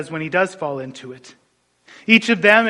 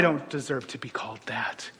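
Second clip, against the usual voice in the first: whisper; 6 semitones lower, 10 dB quieter than usual. Real, not acted. Humble confession to congregation.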